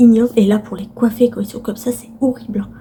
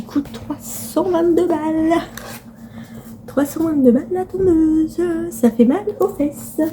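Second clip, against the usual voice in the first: exasperated; 7 semitones higher, slower than usual. Real, not acted. Outraged at the mower's price.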